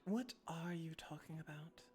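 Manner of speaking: whisper. Acted. Telepathic voice in your mind.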